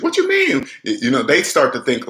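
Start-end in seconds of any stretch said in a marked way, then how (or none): 0.0-0.6 much higher, incredulous squeak of a question; mock the clueless borrower in a shrill-pitched disbelief; high squeaky disbelieving mimic; mocking debt-matrix person. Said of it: "people in the debt matrix".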